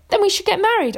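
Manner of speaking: high, impulsive blurt; blurted in a rush. A narrator's reading. Arthur blurting a proposal to Guinevere, in an impulsive rush. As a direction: burst out with it, pitch leaping up. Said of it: "Arthur said in a rush".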